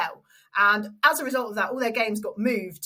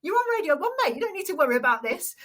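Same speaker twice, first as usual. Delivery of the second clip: high, quick brushing-off of worries; imitated reassurance; 8 semitones higher, faster than usual. Announcer to nervous stand-in before the game, reassuring. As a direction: wave the nerves away, breezily insistent. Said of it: "him reassuring me".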